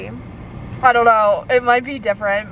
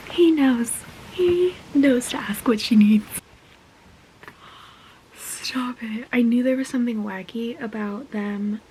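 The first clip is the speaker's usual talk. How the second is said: swooning whisper. Real, not acted. Fan gushing over Xaden, hushed whisper. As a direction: breathe it out like a secret.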